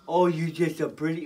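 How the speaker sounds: normal talking voice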